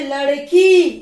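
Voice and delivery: teacher showing the long ई in लड़की; long vowel stressed. Shown as a demonstration. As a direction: lean on the final ई of लड़की. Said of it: "दोनों के समय में बहुत ज्यादा अंतर".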